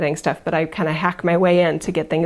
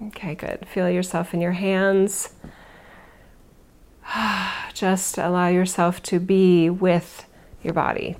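guided meditation. Acted. Leads a relaxation.

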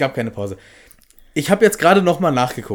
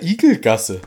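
imitating Harry; 4 semitones higher, 5 dB louder, slower than usual. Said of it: "im Deutschen sagt er".

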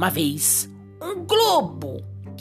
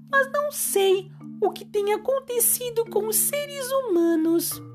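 sad mermaid; 6 semitones higher than usual. Acted. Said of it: "Ela, bem triste e sem jeito".

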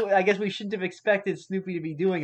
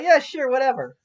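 higher, louder careless brush-off; dismissive; 5 semitones higher, 4 dB louder than usual. Retold or imitated. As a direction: toss it off like you don't care. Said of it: "So you'd just be like".

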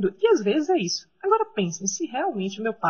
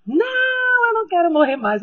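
retold screaming; 11 semitones higher, 5 dB louder than usual. Child pleading with father not to kill her, retold screaming. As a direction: scream in protest like a frightened child. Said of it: "eu comecei a gritar".

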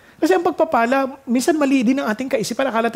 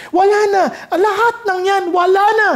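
overjoyed cry; 7 semitones higher than usual. Real, not acted. Joyful climax about no more tears.

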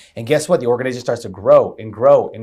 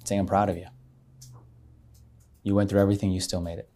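guided meditation voice; 2 semitones lower, 7 dB quieter than usual. Retold or imitated. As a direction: guide them down the path, softly. Retold guided meditation continues.

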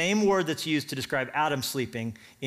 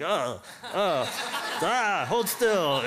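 clumsy fumbling attacker; 3 semitones higher, much slower than usual. Pretend. Clumsy spear attempt mocked.

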